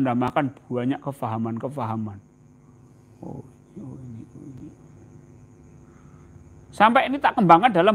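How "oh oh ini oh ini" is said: breathy whispered gasps of discovery. Acted. Preacher acting his own discovery, whispered. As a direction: breathe it out in wonder.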